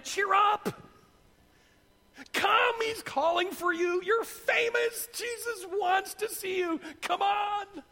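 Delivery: high, loud, drawn-out cheering call; excited calling out. Acted. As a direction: summon someone across a crowd, urging them on. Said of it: "So they called the blind man".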